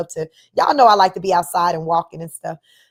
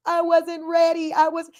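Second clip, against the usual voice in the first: Kevin Hart impression; 9 semitones higher than usual. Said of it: "in my Kevin Hart voice".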